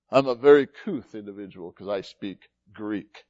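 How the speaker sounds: low, measured mock self-importance; mock-refined Greek speaker. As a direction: play at being very cultured, in a low voice.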